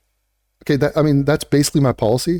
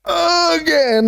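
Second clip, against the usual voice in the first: again sung very high, then falling; 13 semitones higher than usual. Shown as a demonstration. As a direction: squeal again high and let it fall. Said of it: "I said".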